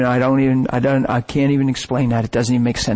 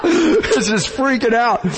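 much higher, giddy and laughing; retelling the wild drive home, laughing high; laughing, freaking out; 10 semitones higher than usual. Retold or imitated. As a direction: overcome with laughing excitement. Reliving overwhelmed car ride, laughing.

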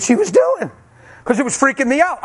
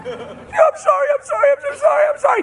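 weeping sorry, pitched way up; sobbing apology. Retold or imitated. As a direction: wail the apology through tears. Wife's sobbing apology replayed.

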